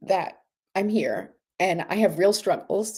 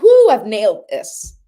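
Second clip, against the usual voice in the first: mock triumphant whoop; 3 semitones higher, 10 dB louder than usual. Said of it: "you're gonna be like".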